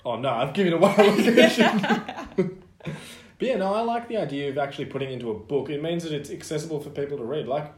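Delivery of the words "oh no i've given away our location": laughing. Real, not acted. Mock dismay at giving away location.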